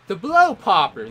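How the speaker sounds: name it like a proud title; proud announcement. Shown as a demonstration. Naming his move to teammates, in a loud proud declaration. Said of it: "There we go".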